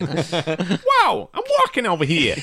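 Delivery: high, hammed-up New York accent. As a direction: squawk it like an indignant New Yorker. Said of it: "New York accent".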